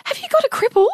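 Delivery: high, loud imitation of a thrilled child; excited son. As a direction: ask it like an overexcited little boy. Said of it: "He was that excited".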